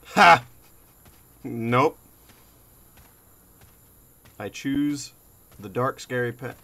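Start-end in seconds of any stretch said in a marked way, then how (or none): 0.1-2.0 loud startled yelp then refusal. Real, not acted. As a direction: jump and yelp. Jump scare reaction.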